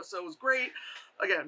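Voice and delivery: usual speaking voice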